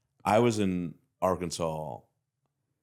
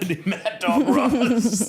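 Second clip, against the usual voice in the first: laughing; 13 semitones higher, 5 dB louder, much slower than usual. Real, not acted. Jokey nickname, laughing.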